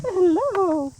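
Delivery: excited hello. Real, not acted. Greeting dogs.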